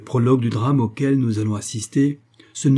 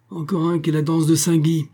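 higher, sneering guard's grumble; grumbled jeer; 7 semitones higher than usual. Acted. Guard grumbling at a sick convict, sneering. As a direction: scoff at the shivering convict. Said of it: "grommela l'homme".

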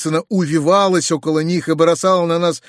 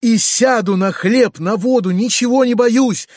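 put-on fearless girl's shout; 6 semitones higher than usual. A narrator's reading. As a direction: fling it back at her, unafraid. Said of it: "кричала Сашенька". (Сашенька дерзко кричит в ответ)

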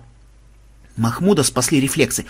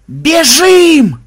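high, very loud cry; panicked shout; 14 semitones higher, 10 dB louder than usual. A narrator's reading. Players fleeing rabbit demons, panicked yell. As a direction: scream the order to run.

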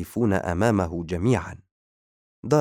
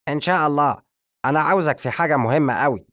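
higher, louder, fast eager voice; magician's voice; 7 semitones higher, 6 dB louder, faster than usual. Acted. The magician addressing the guest, higher and eager. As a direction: rush it out keenly like a scheming wizard. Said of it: "ابتسم الساحر وهو يقول".

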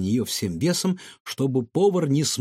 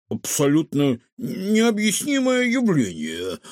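slow, higher, strained utterance; squeezed out; 5 semitones higher, slower than usual. A narrator's reading. Kefir stuffed with stolen pastries, straining to speak. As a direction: force it out laboriously. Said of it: "выдавил он".

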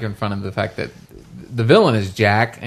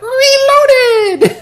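very high, loud squeaky shout; midget squeal; 8 dB louder than usual. Retold or imitated. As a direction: shriek it like a tiny crazed creature. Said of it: "and it's like".

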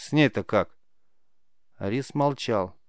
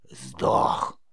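creaky, croaked verdict; matter-of-fact witch rasp; 9 semitones lower than usual. A narrator's reading. Witch sums up the rabbit lesson, blunt creaky rasp. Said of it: "резюмировала ведьма".

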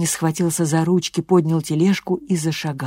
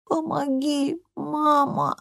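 put-on high, soft raspy child's plea; 9 semitones higher, 5 dB quieter, slower than usual. A narrator's reading. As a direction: rasp it out weakly, pleading. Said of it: "прохрипел он".

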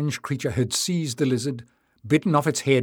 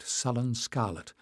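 usual speaking voice

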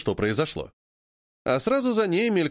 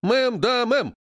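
high, military-style response; drilled chorus reply; 8 semitones higher, 3 dB louder, much slower than usual. A narrator's reading. Seamstresses answer Геля's drill, in obedient shouts. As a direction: answer like recruits on parade. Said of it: "отвечают ей швеи".

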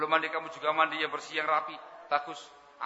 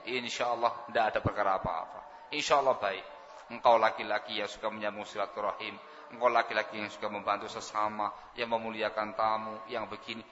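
softer, lower comforting wife; calm him with gentle assurance; reassuring; 7 semitones lower, 4 dB quieter than usual. Acted. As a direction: Khadijah comforting the frightened Prophet, soft and reassuring. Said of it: "Khadijah menenangkan".

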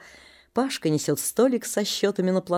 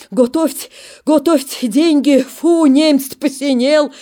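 louder, higher hoarse taunt; сипло; wheezing taunt; 7 semitones higher, 7 dB louder than usual. Acted. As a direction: wheeze while shivering. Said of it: "сипит".

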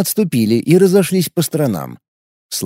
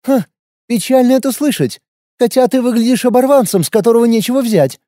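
higher, mocking fake sympathy; sneering fake sweet; 9 semitones higher than usual. A narrator's reading. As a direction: sneer through false pity. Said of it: "хмыкнула девушка".

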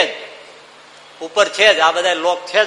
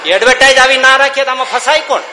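gloating loud retort; 7 semitones higher, 8 dB louder than usual. Acted. Servant retorts: just advertising.

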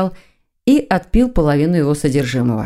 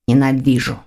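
irritated grumble; 4 semitones lower than usual. Acted. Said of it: "раздражённо проворчал Лефевр".

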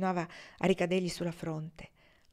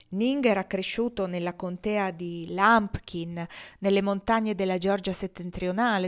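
plain reading voice

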